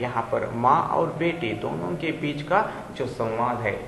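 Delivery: usual speaking voice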